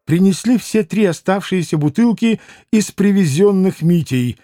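plain reading voice

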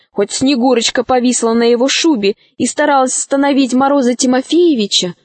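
usual speaking voice

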